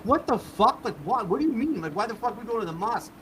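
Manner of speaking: high, incredulous questioning; retold bewildered outburst; baffled protest. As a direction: react in disbelief, pitch jumping up. Acting his baffled reaction to detour.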